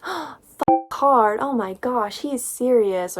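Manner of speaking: mock scandalized heroine; mock gasp.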